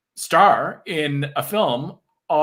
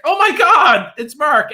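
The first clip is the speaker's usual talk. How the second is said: high, loud gasp of delight; re-enacted excited outburst; thrilled recognition; 11 semitones higher, 6 dB louder, slower than usual. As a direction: exclaim as if spotting a friend onscreen. Delighted reaction on seeing him onscreen.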